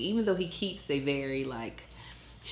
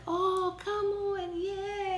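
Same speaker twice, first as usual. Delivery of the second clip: much higher, slow sing-song coaxing; playful mom voice; much slower than usual. Retold or imitated. Mother gently moving her toddler from the blinds, high playful voice. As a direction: play with him, light and fun. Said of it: "funness in my voice".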